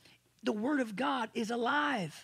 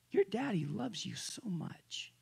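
put-on tender whisper to a child; father whispering love to his daughter; 5 dB quieter than usual. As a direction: breathe it softly like a secret.

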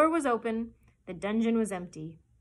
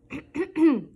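loud throat-clearing cough; mimicked doctor's cough; 7 semitones higher, 14 dB louder than usual. A narrator's reading. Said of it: "just the way Doctor Dolittle used to cough".

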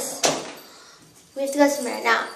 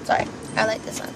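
whispered aside; 4 semitones lower, 9 dB quieter, faster than usual. Real, not acted. Quiet aside, unvoiced.

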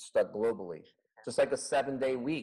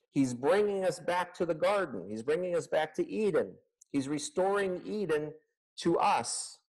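raised, fervent preaching voice; impassioned preaching; 6 semitones higher than usual. Acted. Pastor proclaiming to his congregation, fervent preaching. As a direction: lift them up, proclaiming Eden restored.

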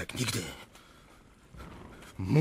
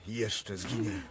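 ordinary talk, nothing marked

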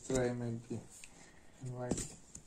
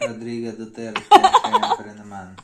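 hammed-up proud; 18 dB louder than usual. Joking shout-out.

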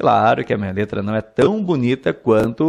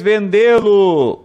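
vendê-lo drawn out, higher and louder; slow, loud emphasis; 7 semitones higher, 5 dB louder, much slower than usual. Shown as a demonstration. Teacher showing the class where the stress falls, slow and loud. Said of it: "Vou acentuar".

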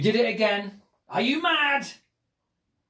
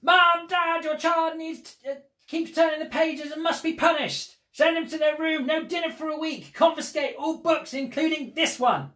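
calling the parents; 9 semitones higher than usual. Acted. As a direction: shout for the parents in mock outrage. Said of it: "Now I'm calling your parents".

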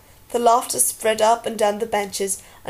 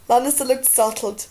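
ordinary delivery, nothing marked

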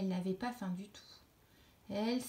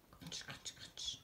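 crunch, crunch, crunch breathed in a whisper; munching noises, softer and softer; 13 dB quieter, much slower than usual. A narrator's reading. Narrator whispers the caterpillar's munching at bedtime.

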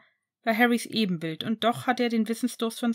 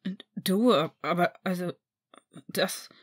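stammering; 3 semitones lower, slower than usual. Acted. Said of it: "stotterte Harry".

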